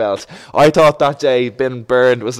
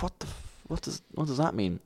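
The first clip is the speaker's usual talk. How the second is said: hushed, breath-only mock disbelief; very soft retold bewilderment; baffled mutter; 3 semitones higher, 13 dB quieter than usual. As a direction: whisper it as if stunned. Retells his baffled reaction.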